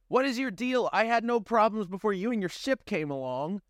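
high, irritated complaint; annoyed young man. Acted. Blaze grumbles at Amanda under fire, high and irritated. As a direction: complain at her.

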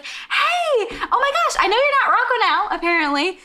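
high, giddy imitation of her greeting; excited. Host acts out Stella greeting her visitor, all excited. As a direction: burst into a high-pitched, laughing hello. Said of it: "so then she's all excited".

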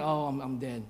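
low, soft thought aloud; quiet, doomed aside; inner dread. Retold or imitated. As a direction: act out a private panic under the voice. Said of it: "In my head, I'm like".

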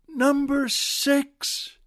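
high, slow, stretched-out call. Acted. New prisoner trying out a numbered joke, calling out. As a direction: call the number out hopefully through bars. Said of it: "he puts his face through".

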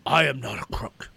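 lightly voiced Nixon line; a brief hint of Nixon's famous denial; Nixon impression. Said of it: "You said first impression".

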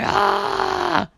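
high hammed-up scream; mock crazy yell. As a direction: scream like you're smashing things. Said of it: "breaking everything and going".

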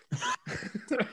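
a quiet high giggle; high soft laugh. Real, not acted. Reader laughing at his own joke, soft high giggle. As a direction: giggle under your breath.

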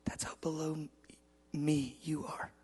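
soft, halting, near-whispered; God's quiet comparison. Retold or imitated. God comparing man to ants, very quietly. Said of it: "He goes".

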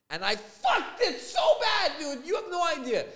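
high, loud self-reproach; exasperated outburst. Real, not acted. Homeowner who botched the painting, exasperated outburst. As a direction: cry it out, stretched and high. Lamenting his own mistake.

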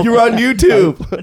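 high slow laughing YouTuber; laughing prank reveal. Pretend. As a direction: stretch it out laughing. Joining prank reveal joke.

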